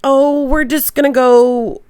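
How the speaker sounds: high, slow, hesitant excuse with hums; nervously squirming out of the invitation; awkward dodge. Retold or imitated. And I was like.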